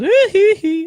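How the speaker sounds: high, loud squealing hee. Retold or imitated.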